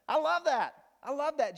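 bursts out high and loud through a laugh; a delighted laughing cry. Real, not acted. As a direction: laugh it out, thrilled. Preacher delighting in the story.

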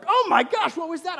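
high, loud startled cry. Retold or imitated. Dad startled by a bang on the window, high loud cry. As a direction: jump at the noise and shout it.

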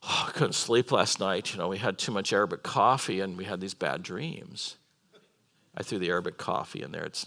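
low, subdued, sighing complaint; mock depressed prisoner. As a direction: play glum and worn out, low and soft. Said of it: "they were sort of depressed".

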